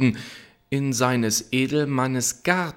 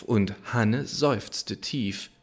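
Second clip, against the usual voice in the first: ordinary delivery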